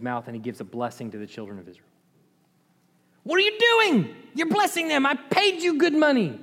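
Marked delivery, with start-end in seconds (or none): 3.2-6.4 high-pitched comic indignation; outraged king. Acted. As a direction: protest in a squealing put-on voice. Angry king of Moab protests.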